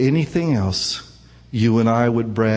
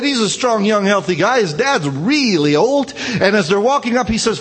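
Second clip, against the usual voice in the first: higher, louder, racing sermon storytelling; fired-up preaching; 6 semitones higher, 3 dB louder, faster than usual. Acted. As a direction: preach it like the story's unfolding right now.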